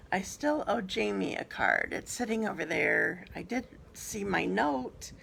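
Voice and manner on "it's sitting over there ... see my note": usual speaking voice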